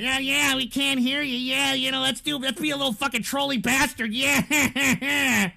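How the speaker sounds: put-on voice imitating gloating trolls; mocking troll voice. Streamer mimicking trolls who claim they can't hear, mocking. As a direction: mimic the chat trolls, ending on a jeering cackle.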